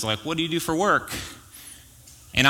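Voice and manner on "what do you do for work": higher, lightly voiced chatty stranger; a hint of an awkward small-talker; mock Jeep guy. Pastor imitating the Jeep owner making small talk, higher. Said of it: "the question is".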